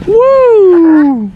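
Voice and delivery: loud high whoop; cheering. Real, not acted. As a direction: cheer him on loudly. Cheering a backflip.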